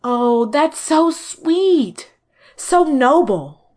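slow, high, gushing delight. Acted. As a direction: coo it out slowly, moved. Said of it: "forgetting to keep her voice down".

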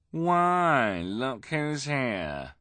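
high, drawn-out taunt; sneering false surprise; mocking greeting. A narrator's reading. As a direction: stretch it out like a rude heckler. Rude man accosts the freaks.

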